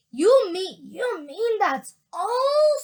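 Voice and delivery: high, loud, drawn-out disbelief; disgusted. A narrator's reading. Mike Teavee sees the tiny grey strip, loudly disgusted. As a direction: stretch the last word in disgusted protest.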